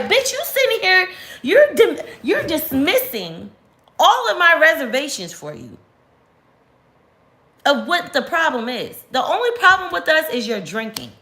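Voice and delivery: put-on high-pitched heated rant at the boyfriend; retold angry rant. Retelling a fight with her boyfriend, high indignant scolding. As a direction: pitch way up, scolding him hard. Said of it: "And I'm like".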